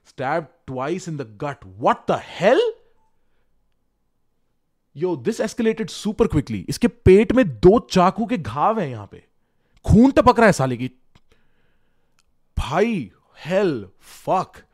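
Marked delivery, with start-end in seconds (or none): 1.8-2.6 higher, louder shocked outburst. Real, not acted. Shocked at a stabbing photo. 5.0-14.6 higher and louder, rising alarm; excited shock. Real, not acted. Shocked at a stabbing photo.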